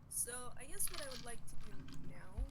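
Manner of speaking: distant quiet mic with a loud hiss. Real, not acted. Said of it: "You're really quiet".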